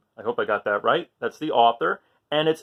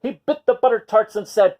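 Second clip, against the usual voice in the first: ordinary delivery, nothing marked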